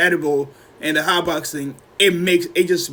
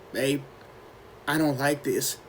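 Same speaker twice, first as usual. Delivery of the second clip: low-key, softer murmur to a partner; quiet unease; 3 semitones lower, 10 dB quieter than usual. Retold or imitated. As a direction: say it softly, just woken and uneasy. Waking up high, telling girlfriend.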